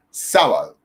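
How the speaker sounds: "sábado" con la fuerza en SÁ; acentúa la primera sílaba de "sábado"; acento correcto. Shown as a demonstration. ("sábado" esdrújula, SÁ-ba-do)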